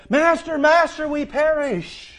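high, loud desperate cry to the master; urgent plea. Acted. Disciples waking Jesus in the storm, high desperate plea. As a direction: call out as if the boat is sinking. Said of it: "their urgency is expressed in the appeal".

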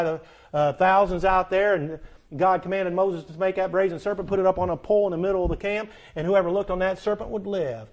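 thundering pulpit delivery; full-force preaching. Acted. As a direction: preach it out to the whole room.

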